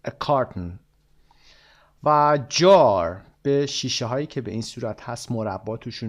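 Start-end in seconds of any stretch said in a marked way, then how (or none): 0.1-0.8 "carton" stressed on the first syllable, KAR-tn, then falling; English stress on "carton", first syllable. Shown as a demonstration. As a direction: say "A carton" with the weight on "car", not the Persian kar-TOON.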